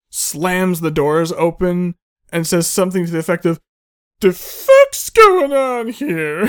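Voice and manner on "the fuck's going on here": high, loud, drawn-out movie-character holler; mock outburst. Character bursting in through slammed doors, high mock holler. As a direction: holler it slowly, hamming up the outrage.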